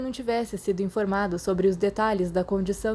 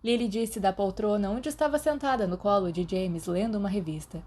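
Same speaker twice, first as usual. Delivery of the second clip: usual speaking voice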